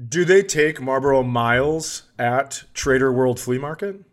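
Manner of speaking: ordinary talk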